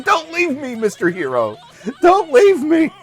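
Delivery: put-on high, pleading kid voice; clingy kid voice. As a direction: beg like a clingy little kid.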